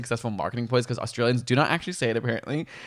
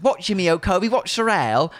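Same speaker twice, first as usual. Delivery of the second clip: put-on British accent; 7 semitones higher, 3 dB louder than usual. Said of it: "let me put on a British accent".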